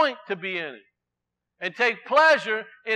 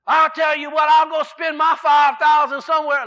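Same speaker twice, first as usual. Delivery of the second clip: loud, higher mock shout of an angry customer; 6 semitones higher, 8 dB louder than usual. Retold customer yelling at the store, loud mock shout. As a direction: yell it like the customer who got skipped in line. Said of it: "loud like that".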